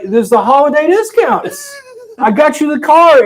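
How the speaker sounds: hammed-up husband; mock husband. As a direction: excuse it brightly. Husband defending purchase.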